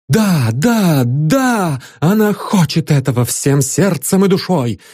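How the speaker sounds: rapturous. Acted. Rapturous woman answers.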